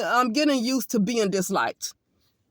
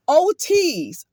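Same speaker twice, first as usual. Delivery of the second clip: normal talking voice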